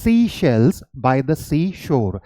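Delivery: "seashells by the seashore" said very slowly; careful slow drill; slow demo. Shown as a demonstration. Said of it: "जब आपने she बोला".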